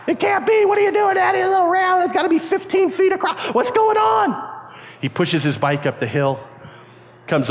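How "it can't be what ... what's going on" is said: high-pitched frantic outburst; mock panicked disbelief. Kids seeing the ramp aimed at Frog Finger, frantic high yelling. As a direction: yell in comic alarm.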